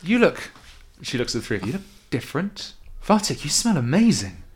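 awkward, flustered greeting; shy young woman. Acted. Arcta voiced by game master.

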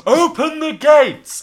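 high, loud and slow command; character calling up to the guard to open the gates. Acted. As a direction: order the gates opened up the tower.

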